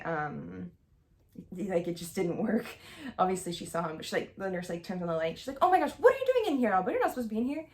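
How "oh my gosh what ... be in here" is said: high, loud, rushed mock alarm; flustered. Nurse finding Albert in the girls' room, flustered. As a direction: imitate a shocked nurse scolding in a rush. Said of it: "she's very flustered".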